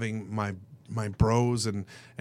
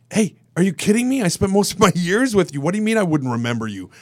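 loud, high, disbelieving; incredulous outburst; 7 semitones higher, 8 dB louder than usual. Retold or imitated. To an old bandmate who thinks he forgot, loud disbelief. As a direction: shout it like he's crazy to ask. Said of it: "And I was like".

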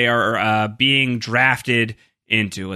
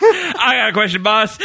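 higher, laughing through the line; amused imitation of Sawyer mouthing off; laughing Sawyer quote; 10 semitones higher, 3 dB louder than usual. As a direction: giggle out Sawyer's cheeky line. Quoting Sawyer while laughing.

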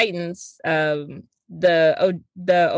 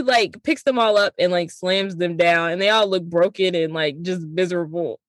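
ordinary talk

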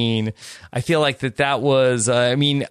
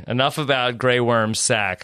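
ordinary delivery

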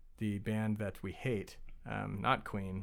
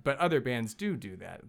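ordinary delivery

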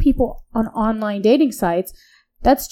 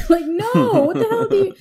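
high, indignant disbelief; 11 semitones higher than usual. Retold or imitated. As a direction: scoff at him, outraged. Indignant retort to guy.

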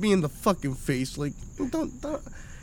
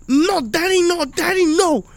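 high-pitched imitated little kid; protesting child; 11 semitones higher than usual. Dad mimics his kid stepping into a fight, high protesting child voice.

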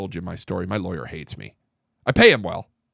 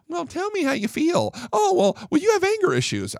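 high, soft, sing-song mockery of a shrink; a snide imitation of a therapist talking down; mocking psychologist; 10 semitones higher, 3 dB quieter than usual. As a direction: parody her prissy prompting, pitched high.

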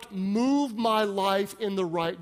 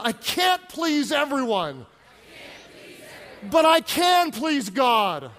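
with freedom; 5 semitones higher than usual. Shown as a demonstration. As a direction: say it like you mean it, from the soul. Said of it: "say it again with some freedom from your soul".

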